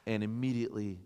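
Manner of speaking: ordinary talk, nothing marked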